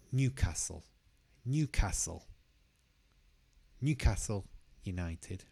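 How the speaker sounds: Newcastle with the weight on New; stress on Newcastle first part. Shown as a demonstration. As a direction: lean on the start of Newcastle. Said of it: "the stress is on the first part".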